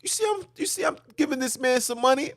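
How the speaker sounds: high, fast, exasperated mock yelling; retold outburst. Snapping at the police officer over the homeless man, high and fast. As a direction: spaz at the cop all over again. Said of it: "I had to spaz on the police officer".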